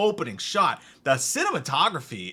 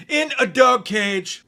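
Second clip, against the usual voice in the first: slow, high, loud repeat in disbelief; incredulous repeat; 8 semitones higher, 5 dB louder, slower than usual. Real, not acted. Reviewer repeating an absurd plot detail, incredulous. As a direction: spell it out slowly, high and loud.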